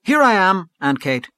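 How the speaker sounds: high, loud, animated call; bright-spirited cry, pitched well up; sudden animation. A narrator's reading. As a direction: burst out with an eager answer. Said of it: "cried Gabriel with sudden animation".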